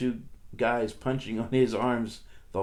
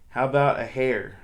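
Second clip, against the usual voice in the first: usual speaking voice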